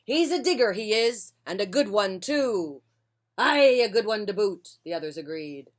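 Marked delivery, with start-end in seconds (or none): 0.0-2.8 loud, higher call to the crew; pirate captain shouting to his crew about the digger; pirate yell. Acted. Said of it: "he yelled back to his crew". 3.4-4.8 loud hearty agreement; pirate crew calling out their agreement; crew cheer. Acted.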